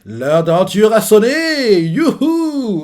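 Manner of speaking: rallying the crew, whooping with joy; happy cheer. Retold or imitated. Luffy eager to start the adventure, high gleeful cheer. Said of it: "Heureux, quoi".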